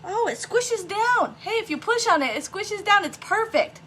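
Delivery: delighted surprise. Real, not acted. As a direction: squeal it like a happy find. Delighted discovery while crafting.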